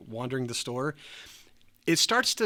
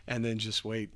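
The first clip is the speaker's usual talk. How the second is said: plain reading voice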